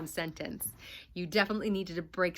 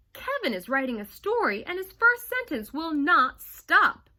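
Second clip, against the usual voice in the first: higher, louder urgent yelp; 8 semitones higher, 7 dB louder than usual. Acted. Darcy telling Missus Smoodle, a louder yelp. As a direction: blurt it out in alarm. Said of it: "yelped Darcy".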